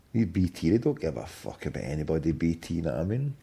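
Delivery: very low, quiet grumble; grumbling to himself. Real, not acted. Canvasser cursing the phone company after a refusal, low grumble. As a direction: complain under your breath, pitch dropped.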